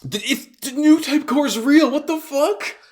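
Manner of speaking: can't believe what they're seeing; shocked disbelief. Real, not acted. Fan hearing the plot twist, shocked and excited.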